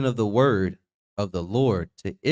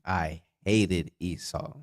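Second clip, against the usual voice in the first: slow, softer, with "hated" stressed; insistent slow reread; 6 semitones lower, 7 dB quieter, slower than usual. Shown as a demonstration. Reader refusing the teacher's joke, slow "hated". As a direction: drag it out, landing on "hated". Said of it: "John three sixteen".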